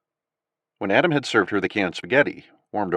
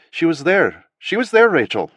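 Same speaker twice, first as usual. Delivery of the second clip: higher, louder insistent cry; urgent recognition; 8 semitones higher, 5 dB louder than usual. Acted. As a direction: press it out, she's sure. Nadia recognizing the daughter.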